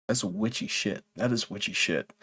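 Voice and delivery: low, very soft muttered verdict; quiet, low aside to yourself; hushed aside. Real, not acted. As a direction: drop right down under your breath.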